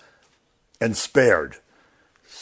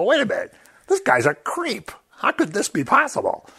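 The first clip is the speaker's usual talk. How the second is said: higher, incredulous protest; mock indignation; 10 semitones higher than usual. Believer scoffing at a newly repentant sinner, incredulous higher voice. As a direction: scoff in disbelief at the convert.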